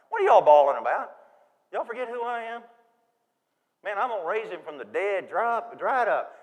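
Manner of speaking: mock scolding. Imagined dismissive Jesus at tomb.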